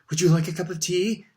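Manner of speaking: high, eager offer; breathless. A narrator's reading. Percy offering his boss tea, breathless. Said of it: "said Percy breathlessly".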